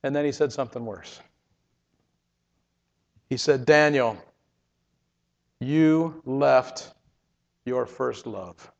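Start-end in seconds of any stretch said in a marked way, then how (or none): 3.7-8.7 low and soft, slow on the last words; a tender, sorrowful correction; gentle, soft rebuke. Retold or imitated. Jesus telling Daniel he left his first love, softly.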